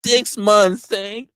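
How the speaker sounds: normal talking voice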